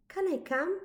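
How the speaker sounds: high, hopeful little voice; eager little child. A narrator's reading. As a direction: ask like a toddler tagging along. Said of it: "said the small person".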